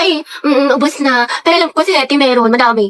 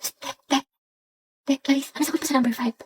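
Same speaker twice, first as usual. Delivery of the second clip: whisper-ask for a test answer; hushed asking; 2 semitones lower, 12 dB quieter than usual. Acted. Student secretly asking classmate during a test. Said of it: "Lakasan mo nga, Andrea".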